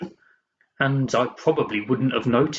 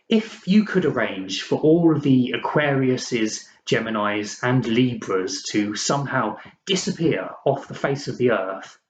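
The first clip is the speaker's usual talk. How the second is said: ordinary delivery, nothing marked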